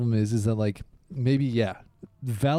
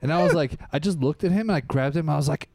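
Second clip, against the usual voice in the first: ordinary talk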